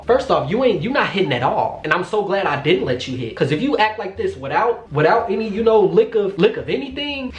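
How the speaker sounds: scornful retort. Retold or imitated. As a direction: lay it out with rising disbelief. Answers his demand as if present.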